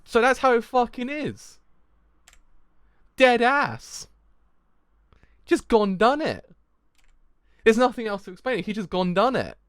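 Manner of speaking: loud, high, emphatic amazement; awed disbelief. Real, not acted. Fan stunned that he just did it, loud awe. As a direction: proclaim it in real astonishment.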